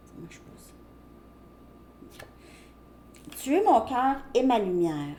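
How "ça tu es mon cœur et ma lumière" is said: normal talking voice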